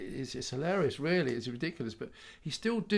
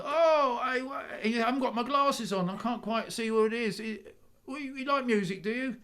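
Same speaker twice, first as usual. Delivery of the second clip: put-on high, chatty Polish dad accent; dad boasting in a Polish accent; 11 semitones higher than usual. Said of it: "I keep doing the Polish accent".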